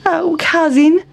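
high squeaky complaining mouse; Town Mouse's whine. A narrator's reading. Town Mouse grumbling to cousin, high squeaky whine. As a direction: list the grievances like a fussy mouse. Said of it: "said Town Mouse".

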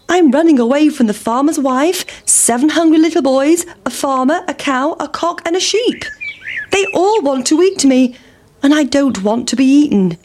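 high-pitched, fretful pancake voice; anxious pancake. Acted. Pancake telling the pig of its chasers, anxious. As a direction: list the pursuers in a high, hurried-sounding plea.

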